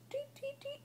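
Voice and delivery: bird chirp. Shown as a demonstration. Bird sound for toddler.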